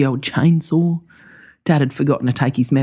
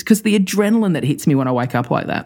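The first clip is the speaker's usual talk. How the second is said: normal talking voice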